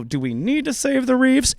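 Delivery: high mock doubt. Imagining seeing an ugly fish, mock doubting question. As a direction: wonder aloud in disgust. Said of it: "and you go".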